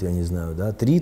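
ordinary talk, nothing marked

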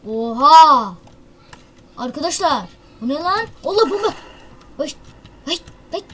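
loud, high shocked cry; startled outburst. Real, not acted. Gamer spots a bomb and shouts to teammates in shock. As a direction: jolt of real alarm at the bomb.

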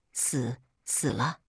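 slow, quiet, broken terror; a shaking mother gasps it out, barely able to speak; stammering in fear. A narrator's reading. Mother finds her son dead, stammering in fear. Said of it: "话也说不利索".

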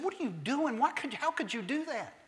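high, exasperated husband, a touch mock; mock angry husband. Husband to wife over the dress, mock exasperated. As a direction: scold her like a stunned husband.

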